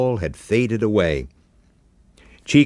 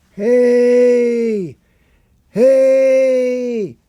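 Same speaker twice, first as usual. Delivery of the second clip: much higher, loud calling out; distant shout; 7 dB louder than usual. A narrator's reading. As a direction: yell across the beach to get attention. Boy calling from far off.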